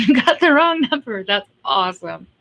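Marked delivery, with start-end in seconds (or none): 0.1-1.2 laughing while talking. Real, not acted. Laughing while retelling a text.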